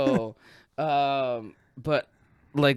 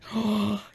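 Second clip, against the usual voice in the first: higher, sharp gasp; mimicked shocked gasp; 6 semitones higher, 4 dB quieter than usual. Friend laughing at the puker, mimicked gasp. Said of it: "She's like".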